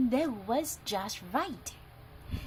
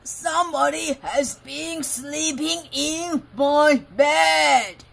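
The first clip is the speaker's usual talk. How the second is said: loud booming bear growl building to a shout; Father Bear growls; 4 semitones higher, 11 dB louder, slower than usual. Acted. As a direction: roar it like an angry papa bear. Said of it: "growled Father Bear in his great big voice".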